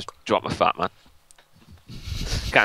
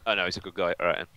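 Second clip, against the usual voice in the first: ordinary talk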